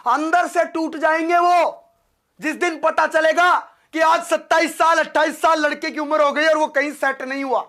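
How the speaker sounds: loud, high, urgent appeal to the class; impassioned preaching. Acted. Teacher pleading with students about their parents, loud impassioned preaching. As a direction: pour your heart out to the students.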